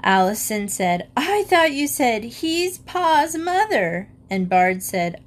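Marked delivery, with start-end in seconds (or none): 1.2-4.1 lightly voiced child, high; child mishearing on purpose. A narrator's reading. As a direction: innocent high child asking.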